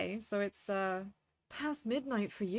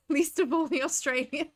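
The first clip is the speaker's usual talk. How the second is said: talking through real laughter, higher and louder; laughing; 6 semitones higher, 6 dB louder than usual. Real, not acted. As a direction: let the words bubble out in giggles. Laughs through own Australian joke.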